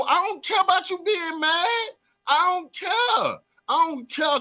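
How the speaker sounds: high, put-on whiny voice, teasing; mock whiny don't care. Host teasing annoyed fans, in a high whiny put-on voice. Host dismissing fans' anger.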